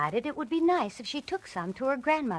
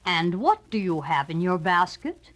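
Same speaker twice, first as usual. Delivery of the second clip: a hint of low wolf; lower, sly wolf question; lightly voiced wolf; 3 semitones lower than usual. A narrator's reading. Wolf questioning the girl, lightly low.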